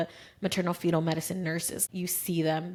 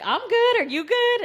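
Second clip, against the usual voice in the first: much higher and louder, matching the energy; excited reply; 8 dB louder than usual. Retold or imitated. OB answering the hyped-up mother, excited and loud. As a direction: bounce the hype right back.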